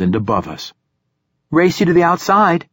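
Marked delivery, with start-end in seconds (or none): 1.5-2.7 put-on high, eager girl's dare; playful challenge. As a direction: toss out a playful race dare. Girl challenges narrator playfully.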